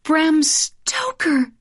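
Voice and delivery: high, slow exclamation of discovery; sudden realisation. Acted. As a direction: cry out as it clicks. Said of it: "she cried".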